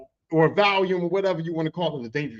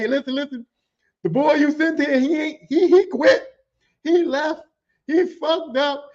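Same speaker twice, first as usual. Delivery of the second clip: high-pitched, eager retold report; gleeful tattling; 7 semitones higher than usual. Telling the big boss the kid quit, gleefully. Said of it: "And I'm laughing. I'm basking in my motherfucking glory".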